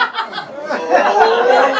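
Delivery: high, loud laughing burst; burst of high laughter. Real, not acted. Storyteller cracking up at his own name called out. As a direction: crack up in disbelief.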